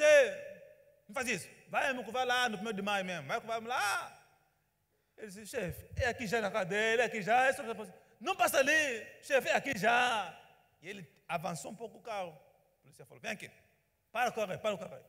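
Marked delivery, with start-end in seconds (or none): 0.0-4.3 loud, higher, commanding call; police shout. Retold or imitated. Traffic cop yelling at the taxi driver, loud order. 5.5-10.3 much louder and higher, pleading insistently; driver's loud plea. Retold or imitated. Taxi driver pleading loudly with the policeman. 13.2-15.0 loud, higher barked command; police order. Retold or imitated. Policeman ordering the taxi to pull over, loud command. Cop ordering the car to stop.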